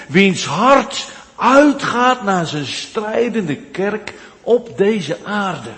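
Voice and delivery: high, loud pulpit proclamation, slowing on the last words; impassioned preacher lifting the church; fervent preaching. Acted. Preaching at full force.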